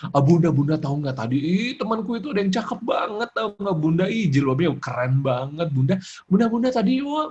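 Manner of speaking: excited, gushing schoolgirl chatter; chattering little girl. Acted. Daughter telling mother about school, excited chatter. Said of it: "ramenya luar biasa".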